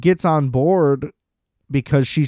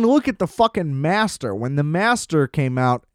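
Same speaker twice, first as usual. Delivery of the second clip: ordinary talk, nothing marked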